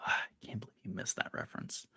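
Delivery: breathy whispered letdown; disappointed whisper. Acted. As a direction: sigh it out under your breath. Mock disappointment after missed reference.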